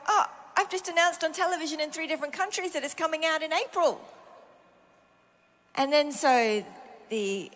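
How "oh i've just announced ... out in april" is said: higher, hurried retold objection; alarmed protest. Author writes back about a cancelled book contract, alarmed protest. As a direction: protest it in a rush, pitched up.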